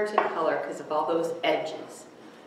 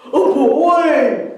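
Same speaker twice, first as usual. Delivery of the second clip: loud, higher bear's yell; 9 semitones higher, 14 dB louder than usual. Acted. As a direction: yell in alarm as a bear. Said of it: "yelled the bear".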